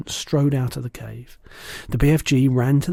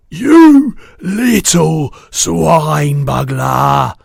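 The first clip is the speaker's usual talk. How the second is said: slow, much louder roaring insult; roaring giant; 3 semitones higher, 11 dB louder, much slower than usual. A narrator's reading. Bloodbottler roaring at the BFG, furious. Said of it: "roared the blood bottler".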